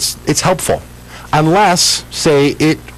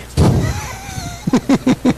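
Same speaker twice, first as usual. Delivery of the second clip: burst of laughter; 4 dB quieter than usual. Real, not acted. Reacting to the autocorrect error.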